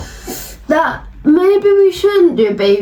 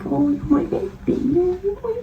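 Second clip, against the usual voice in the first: put-on cooing baby talk, rising higher each time; 8 semitones higher, 6 dB quieter than usual. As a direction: coo like talking to a baby. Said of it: "you expect to be more taken care of".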